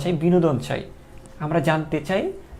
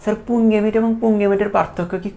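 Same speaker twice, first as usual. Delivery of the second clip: lightly voiced whining student, a bit higher; whiny student; 4 semitones higher than usual. Teacher mimicking a student fussing over spelling, mild whine. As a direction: mimic a fussy student who complains. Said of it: "এটা নিয়ে আবার কান্নাকাটি করবে".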